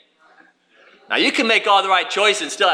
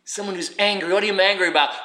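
ordinary delivery

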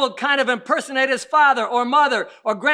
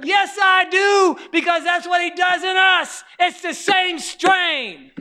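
slow, loud, high preacher cry; preaching at full force; 7 semitones higher, 5 dB louder, slower than usual. Acted. Preacher shouting his answer to the church. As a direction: drive it home slowly.

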